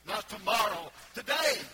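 loud and high, today ringing out; preacher's shouted TODAY; shouted emphasis. Real, not acted. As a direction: hammer home today. Preacher stressing today over tomorrow.

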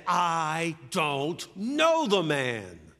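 very slow, drawn-out shouted denial; cursing oath. Acted. Peter curses and denies Jesus, slow shouted oath. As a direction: shout it, stretching every word to breaking point. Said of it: "called down curses and swore another oath".

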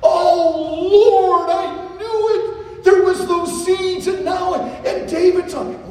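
high, loud, drawn out lament; David's slow, loud anguished lament; anguished cry. Acted. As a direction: wail it slowly as if heartbroken. Preacher acting David's anguish.

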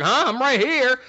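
high, breezy and casual; casual reply. Retold or imitated. Futon answers his master casually, high and breezy. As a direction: toss it off lightly. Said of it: "normal talking voice".

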